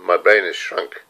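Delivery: ordinary talk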